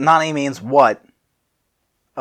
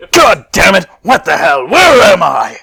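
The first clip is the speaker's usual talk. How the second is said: loud high shout; loud angry Vegeta yell; 6 semitones higher, 11 dB louder than usual. Acted. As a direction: shout in confused fury. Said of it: "A raspy voice shouts".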